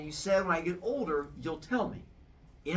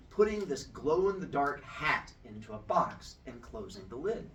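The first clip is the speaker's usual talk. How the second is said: usual speaking voice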